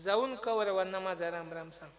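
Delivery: low, soft confidential tone; spoken into ear. Acted. Krishna gives his brother a message, spoken into the ear. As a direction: pass it on like a secret. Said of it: "हे मोठ्याने सांगू शकत नव्हता का".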